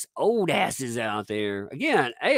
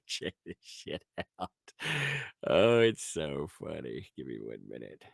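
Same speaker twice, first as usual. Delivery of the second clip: ordinary delivery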